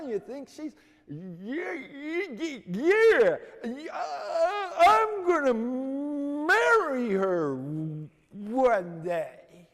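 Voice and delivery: high, loud stammer stretched out slowly; stuttering vow. Retold or imitated. Johnny stammers to the mocking boys he'll marry her. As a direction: drag out the vow, high and straining.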